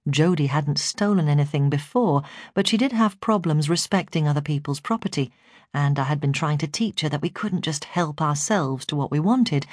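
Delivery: ordinary delivery, nothing marked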